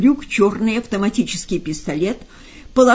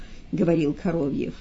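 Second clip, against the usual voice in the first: plain reading voice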